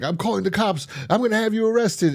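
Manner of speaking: high-pitched retold outrage; mock indignant threat. Acts out landlord threatening arrest.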